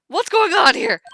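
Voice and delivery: high, loud startled question; alarmed exclamation. Acted. Lawyer walking in on the detective's commotion, alarmed. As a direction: burst in, loudly taken aback.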